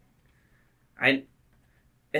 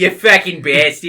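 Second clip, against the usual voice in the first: loud mock Boston accent; hammed-up Boston accent, louder; fake Boston accent; 5 semitones higher, 12 dB louder than usual. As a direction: echo the curse in a bad Boston voice. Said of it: "fake Boston slang".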